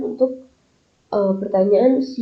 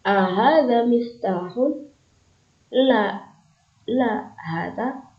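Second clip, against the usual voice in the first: slow model question and answer; 2 semitones higher, much slower than usual. Shown as a demonstration. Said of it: "seperti itu".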